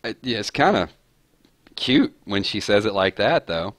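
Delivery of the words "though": usual speaking voice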